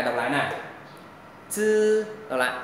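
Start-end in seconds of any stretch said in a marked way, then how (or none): 1.5-2.0 "Chư" hissed like "ts", held high and level; tongue lightly on front teeth for a long "Chư"; tongue-tip dental sound. Shown as a demonstration. Teacher models tongue placement for students, tongue-tip dental sound. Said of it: "đầu lưỡi chạm nhẹ răng cửa thôi".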